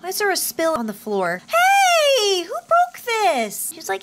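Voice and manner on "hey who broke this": loud, high mock outrage; slow, high-pitched scolding holler; indignant call-out. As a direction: yell out to demand the culprit, drawn out. Calls out over spill.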